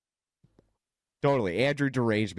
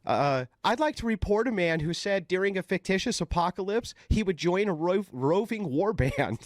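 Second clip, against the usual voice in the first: higher-pitched earnest tattletale; mock complaint caller; 9 semitones higher than usual. Caller reporting a man to CPS, higher mock-earnest voice. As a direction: lodge a report in a raised, put-on caller voice. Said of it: "I respond with".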